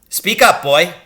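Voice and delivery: loud, high order; booming command; shouted demand. Acted. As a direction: bark at the boy to speak. Teacher demanding an answer.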